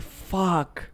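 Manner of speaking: soft, drawn-out curse; retold stunned reaction. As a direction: let the swear out softly, stunned. Retold stunned reaction to mother's confession.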